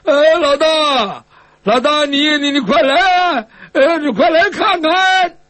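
high, drawn-out call to someone far off. Acted. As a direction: call big brother over under duress, slowly and high. Forced to call his elder brother over. (拉长声音高声呼唤)

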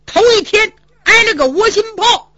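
aggrieved protest. Acted. Hu Dahai protesting to Zhu Yuanzhang, aggrieved and high. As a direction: vent the pent-up wrongs.

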